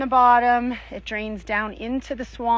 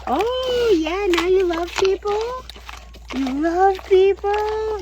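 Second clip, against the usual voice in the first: high, loud teasing question; playfully mock the pig; teasing the pig about loving people; 10 semitones higher, 6 dB louder than usual. Real, not acted.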